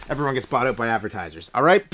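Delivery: usual speaking voice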